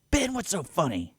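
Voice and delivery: high mock mom demanding; imitating an annoyed mom, high. Mom voiced in story.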